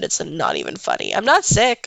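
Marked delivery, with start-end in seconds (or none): none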